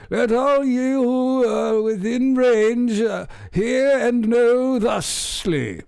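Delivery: high, slow pompous proclamation; mock town crier. Reading the statement to viewers as a town crier, pompous and slow. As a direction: declaim it like a hammed-up herald. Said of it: "like the town crier".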